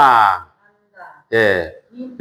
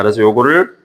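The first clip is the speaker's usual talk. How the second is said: ordinary delivery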